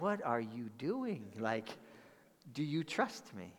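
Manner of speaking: soft, quiet question from God; hushed divine voice; God's gentle voice. Retold or imitated. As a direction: ask it softly, like a caring father.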